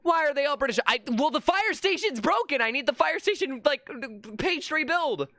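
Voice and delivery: high-pitched, worked-up complaining; exasperated rant. Real, not acted. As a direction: protest in a high, strained pitch. Exasperated at the game.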